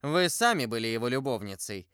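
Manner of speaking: ordinary delivery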